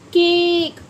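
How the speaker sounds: केक with a long, stressed ए; long vowel cake. Shown as a demonstration. Teacher modelling केक for the children. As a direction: say केक loudly, vowel held. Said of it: "केक तो नहीं पढ़ रहे ना".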